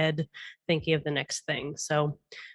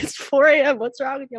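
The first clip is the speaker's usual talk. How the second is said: incredulous partner; 9 semitones higher, 7 dB louder than usual. Retold or imitated. Partner baffled at 4 AM.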